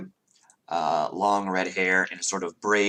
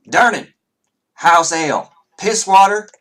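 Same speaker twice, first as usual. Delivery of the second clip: high, loud barmaid call; calling across the bar; 11 semitones higher, 10 dB louder than usual. Acted. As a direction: holler the order to the bar. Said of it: "her voice carries".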